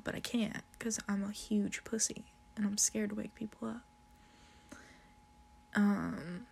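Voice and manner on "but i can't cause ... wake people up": hushed whisper, scared to wake him; a sneaky whisper in the dark. Retold or imitated. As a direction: breathe it out so no one wakes. Whispered confession of being scared.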